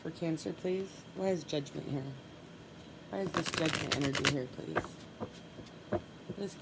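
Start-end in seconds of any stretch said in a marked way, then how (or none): none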